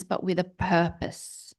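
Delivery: ordinary talk, nothing marked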